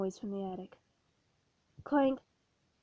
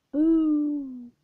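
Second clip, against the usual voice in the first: ghostly boo; 3 semitones higher, 7 dB louder than usual. Acted. Ghost tries to scare him.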